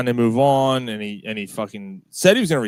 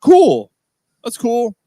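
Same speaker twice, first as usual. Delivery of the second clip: high, loud burst of approval; enthusiastic approval; 12 semitones higher, 9 dB louder, slower than usual. Retold or imitated. Fan reacting to the bench coach hire, high loud approval. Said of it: "I'm like".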